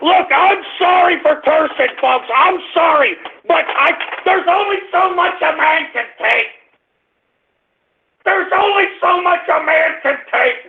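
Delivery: high-pitched, exasperated apology; overwrought pleading. Real, not acted. Host apologising to folks for cursing, overwrought pleading. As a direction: plead at the end of your rope.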